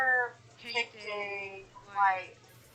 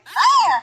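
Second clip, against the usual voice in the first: loud, higher mock "Ah!"; retold yelp; 7 semitones higher, 8 dB louder than usual. Player retelling little Rast splooting on the floor, mock yelp. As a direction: yelp it out in imitation.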